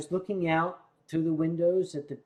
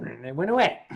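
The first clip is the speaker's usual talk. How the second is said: ordinary talk, nothing marked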